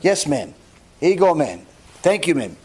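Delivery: low, reserved courtesy to a stranger; demonstrating brief, impersonal politeness; cordial, respectful. Shown as a demonstration. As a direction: hand it over politely, keeping the voice low. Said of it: "will call each other ma'am".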